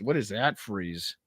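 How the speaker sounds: ordinary talk, nothing marked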